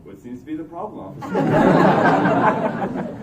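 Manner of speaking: playing it cool, drawn-out officer; smug and unbothered, stretch the end; cool casual. Naked friend greets the cop, mock cool. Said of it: "and says".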